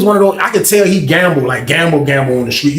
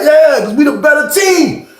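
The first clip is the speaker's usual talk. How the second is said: gloating shout; 12 semitones higher than usual. Retold or imitated. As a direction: crow about being the better team. Fred gloating about winning.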